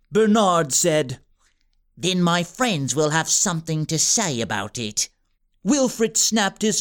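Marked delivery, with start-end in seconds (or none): 2.0-5.1 low, smug menace; scheming villain. A narrator's reading. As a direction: purr the threat down at them. Wilfred threat.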